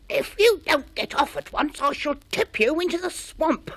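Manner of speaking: high, loud and gruff swamp monster warning; angry creature voice. A narrator's reading. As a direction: threaten him from below, loud and cross. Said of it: "said the angry voice".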